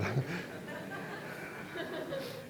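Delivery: wheezy, voiceless laugh; breathy, trailing laughter. Real, not acted. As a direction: laugh softly at your own joke. Laughs after his own joke.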